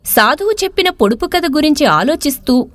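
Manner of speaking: usual speaking voice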